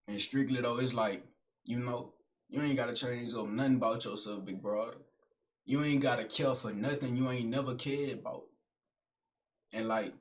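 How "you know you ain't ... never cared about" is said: usual speaking voice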